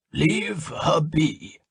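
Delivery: slow, measured command. A narrator's reading. Old Felsafel shielding Kalia, slow commanding order. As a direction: lay down the law, very slowly. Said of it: "Felsafel commanded".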